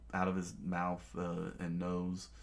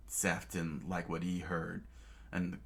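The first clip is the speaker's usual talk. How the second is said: ordinary delivery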